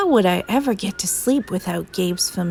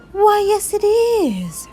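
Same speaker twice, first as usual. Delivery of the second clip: very high, delighted silky monster; preening high monster voice; 13 semitones higher, 4 dB louder than usual. A narrator's reading. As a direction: answer proudly, way up high. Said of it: "in a high, silky voice".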